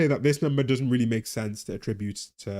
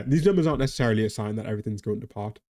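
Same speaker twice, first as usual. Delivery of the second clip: usual speaking voice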